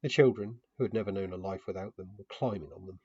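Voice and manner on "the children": usual speaking voice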